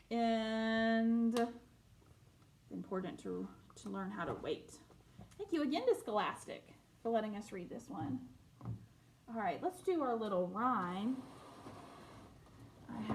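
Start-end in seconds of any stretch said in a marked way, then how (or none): none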